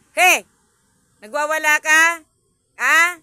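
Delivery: very loud, high yelled challenge; very loud mock shout. Real, not acted. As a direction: yell at the thrashing cat. Yelling at the wild cat.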